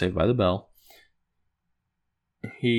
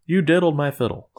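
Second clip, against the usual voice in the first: accusing; 6 semitones higher, 4 dB louder than usual. Retold or imitated.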